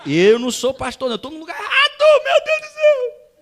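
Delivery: very loud plea climbing into a high scream; hammed-up desperate shout. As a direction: wail it to heaven in mock despair. Same plea repeated, screamed to God. (desespero exagerado, aos gritos)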